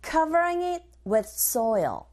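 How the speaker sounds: slow, careful drill reading; teacher modelling the phrase slowly; slow model line. Shown as a demonstration. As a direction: say it slowly for students to copy. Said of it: "고고 리스닝 one".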